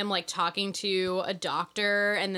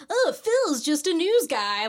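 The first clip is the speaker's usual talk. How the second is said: higher, drawn-out comedy trailer patter; 6 semitones higher, 4 dB louder, slower than usual. Acted. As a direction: mimic an upbeat comedy trailer pitch. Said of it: "your standard kind of, like, comedy trailer".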